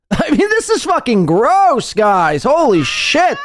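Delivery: high-pitched, exasperated exclaiming; disgusted outburst. Real, not acted. Host reacting to tight pants on camera, high disgusted outburst.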